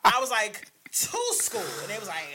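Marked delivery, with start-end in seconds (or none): 0.9-1.6 high fake-innocent reply; innocent answer. Telling the truancy officer where he's headed, high and innocent. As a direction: answer sweetly as if obvious.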